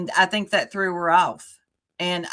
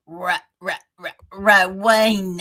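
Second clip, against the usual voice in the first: stuttered, louder put-on voice; Rowena impression; 5 dB louder than usual. Host naming whom she tried to impersonate, mimicking voice. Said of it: "Try to do an impersonation".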